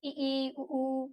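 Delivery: इ, ई, उ said softly with the mouth closed; quiet, closed-mouth vowels; vowel demo. Shown as a demonstration. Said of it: "बिल्कुल मुंह बंद करके हम उच्चारण कर लेंगे". (मुंह बंद करके उच्चारण)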